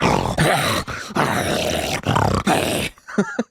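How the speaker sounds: wild monster snarls and growls; drawn-out imitated snarling; feral snarling. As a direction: make feral rock-and-roll beast noises. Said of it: "this snarling feral being".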